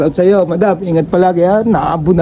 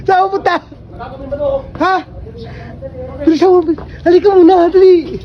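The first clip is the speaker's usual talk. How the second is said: calling out; 8 semitones higher, faster than usual. Real, not acted. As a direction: call him over urgently. Calling someone over loudly.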